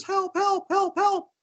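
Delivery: high mouse cries for help; squeaky mouse. Acted. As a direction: squeak it out like a little mouse in trouble. Little mouse calling from quicksand.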